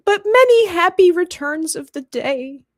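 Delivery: high, loud, teary birthday wish; mock tearful. Piglet wishes Eeyore well despite crushed balloon, mock tearful. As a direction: brave wish through pretend tears. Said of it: "And he's crying".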